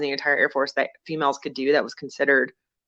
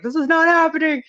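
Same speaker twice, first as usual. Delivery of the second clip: high, loud disbelief; mock panic; 12 semitones higher, 5 dB louder than usual. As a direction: squeal it like you're freaking out. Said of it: "I'm in a complete panic mode".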